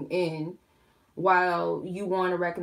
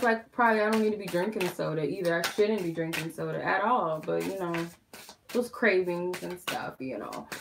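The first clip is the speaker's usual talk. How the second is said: normal talking voice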